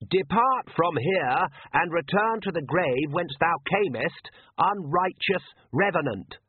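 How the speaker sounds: a nervous priest reciting a holy rite slowly; young priest intoning. Acted.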